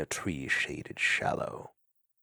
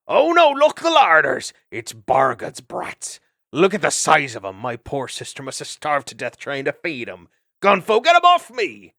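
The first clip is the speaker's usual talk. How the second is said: mock-horrified old aunt; 6 semitones higher, 10 dB louder than usual.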